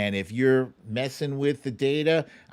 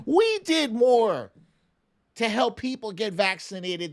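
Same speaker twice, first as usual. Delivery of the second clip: indignant emphasis; 8 semitones higher, 3 dB louder than usual. Real, not acted. Contrasting ordinary people with Trump's family, emphatic.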